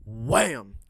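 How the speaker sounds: sudden loud "wham"; comic impact. Retold or imitated. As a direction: punch it out like a blow landing. Voices a comic's impact sound.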